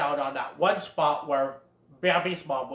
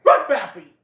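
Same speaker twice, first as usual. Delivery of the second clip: much louder command; urgent shout; 5 semitones higher, 11 dB louder than usual. Retold or imitated. Great Prince repeating the warning, loud command. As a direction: bark the order again. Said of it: "the Great Prince says".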